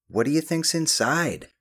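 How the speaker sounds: wondering aloud. A narrator's reading. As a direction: throw the question out to nobody in particular. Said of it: "Ben asked to nobody in particular".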